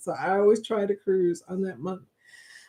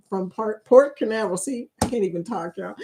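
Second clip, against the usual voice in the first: usual speaking voice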